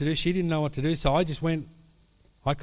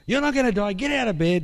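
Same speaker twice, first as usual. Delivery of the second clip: much higher, louder, insistent; wife ordering the sick friend up, high and loud; forceful rebuke; 8 semitones higher, 4 dB louder than usual. Retold or imitated. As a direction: refuse her despair outright.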